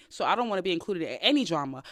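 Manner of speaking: ordinary talk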